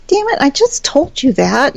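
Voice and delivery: half-joking I-told-you-so; mock exasperation. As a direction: protest like you warned them already. Retelling her vexed reply to group.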